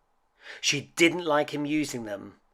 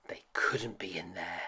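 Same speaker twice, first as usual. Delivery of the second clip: fearful whisper; 5 semitones lower, 8 dB quieter than usual. A narrator's reading. Fearful suspense.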